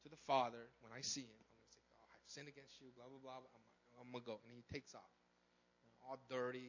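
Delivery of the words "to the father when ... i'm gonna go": low, very soft muttering to himself; rehearsing a speech. Acted. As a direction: mumble through a practised confession. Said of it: "He makes up this speech".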